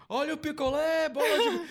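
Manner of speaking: high, slow vendor sing-song; singsong beach vendor call. Pretend. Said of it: "só passar assim na novela".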